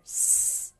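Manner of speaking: "S" sounded alone as a voiceless hiss. Shown as a demonstration. Teacher shows the sound in sister, hissed S. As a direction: hold the first sound of sister as "S". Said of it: "Ang S sound like sister".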